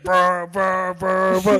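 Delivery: buzzing "brr" pulses like blaring music; rhythmic mouth-made bass thumps; imitated club noise. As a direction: mimic music drowning out the conversation. Imitating loud club music drowning speech.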